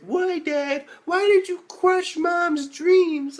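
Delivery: high, slow, hammed-up plea; mock anguished kid. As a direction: wail it at dad like a wounded child. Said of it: "he's like".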